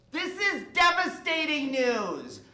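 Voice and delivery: high, loud comic lament; hammed-up outcry of dismay; mock devastation. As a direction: wail the bad news for laughs. Comic outcry at bad news.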